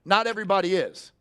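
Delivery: ordinary talk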